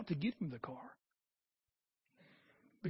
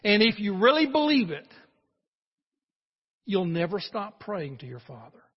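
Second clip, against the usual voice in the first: loud preaching; 4 semitones higher, 8 dB louder than usual. Real, not acted. Sermon climax.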